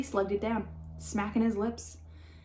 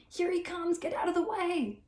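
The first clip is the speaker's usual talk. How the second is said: higher-pitched yell; mild panicked yell; 9 semitones higher than usual. A narrator's reading. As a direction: shout a panicked warning while running.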